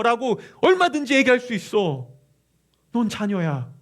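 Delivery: loud, high, insistent argument; arguing advocate. Acted. Holy Spirit to the believer about being a child, insistent. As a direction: argue the child's case with full force. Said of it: "끊임없이 논쟁하며 이렇게 얘기합니다".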